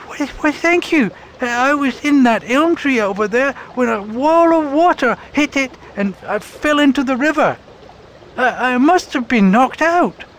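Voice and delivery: very high, dazed little bird voice; a stunned bird stammering her thanks; Rose the robin. Acted. Robin thanking her rescuers, very high and dazed.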